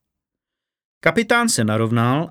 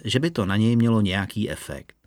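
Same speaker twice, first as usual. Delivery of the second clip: ordinary delivery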